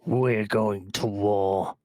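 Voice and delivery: slow, grim declaration of war; gruff boss voice. Acted. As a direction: growl it out slowly like a mob boss. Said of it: "The very gruff voice of your boss".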